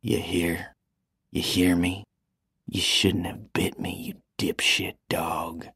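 cold, slow whispered threat; menacing whisper, slowing at the end. Acted. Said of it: "Greg whispered".

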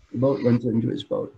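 ordinary talk, nothing marked

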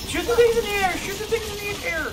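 high, loud repeated shouting; exasperated yell. Real, not acted. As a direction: scream the order in frustration. Repeated order now yelled.

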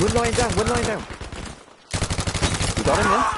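excited callout. Real, not acted. Spotting enemies mid firefight.

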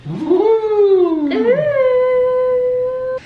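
high, loud delighted ooh; a long, sung-out ooh of delight at the food; excited ooh. Real, not acted. Host sees the egg rolls arrive, loud high excited ooh.

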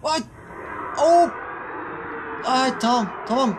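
shocked high cry, then tamam; startled. Real, not acted. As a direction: jump in fright and settle. Jumpscare by creature.

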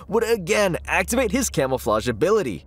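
usual speaking voice

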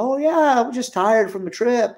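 high, breezy oh yeah; mock-casual cover-up. Hungover, brushing off the office girl, high breezy denial. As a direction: play it cool while hiding the hangover.

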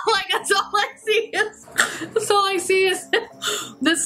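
high, squeaky words through laughter; laughing hard. Real, not acted. Vlogger can't get over the shape, talking through laughter.

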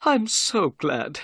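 slow, high character voice, relieved; nervous little man. Acted. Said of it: "said Thaddeus Sholto".

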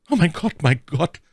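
high, distressed maid's voice; stammered dismay. A narrator's reading. Maid Cathy as the false count arrives, stammering in dismay. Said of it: "stammelte Cathy".

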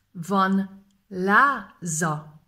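Van láza dragged very slowly. Shown as a demonstration. Teacher repeats the question slowly for learners. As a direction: draw each syllable out. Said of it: "slow repeat".

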